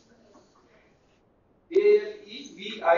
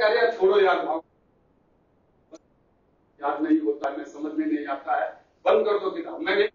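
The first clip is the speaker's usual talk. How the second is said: mock frustrated student, sinking into a lower voice; acted giving up; 6 semitones lower than usual. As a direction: voice a mind that gives up, exasperated then low and dejected. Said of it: "आपका mind कहेगा कि". (हार मानते मन की नकली झुंझलाहट, फिर धीमा निराश स्वर)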